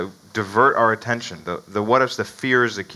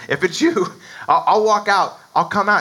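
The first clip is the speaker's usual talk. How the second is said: eager Peter; 7 semitones higher than usual. Retold or imitated. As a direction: blurt it out like brash Peter. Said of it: "he is the one who says".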